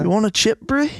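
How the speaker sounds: put-on high, bright-pitched New Zealand accent. Offering a chip to Brie, playful New Zealand accent. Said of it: "a New Zealand accent instead".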